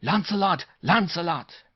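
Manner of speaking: high, loud excited Frenchman; loud cheer in a French accent; French-accented yell. A narrator's reading.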